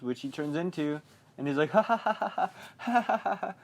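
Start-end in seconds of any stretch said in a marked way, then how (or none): 1.7-3.5 high staccato mock villain laugh; imitated mad clown cackle; Pennywise. Clown laughing at Ben, high put-on cackle. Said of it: "He's just laughing at him".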